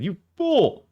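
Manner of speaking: high shouted "You fool!"; catchphrase shout. Acted. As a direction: belt it up high like a villain's taunt. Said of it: "Used to be my catchphrase".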